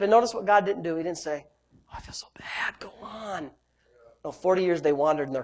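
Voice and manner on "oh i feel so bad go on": whispered fake pity; very soft, hushed imitation of giving in; mock sympathy. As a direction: feign feeling sorry in a whisper. Voices the pitying God he denies.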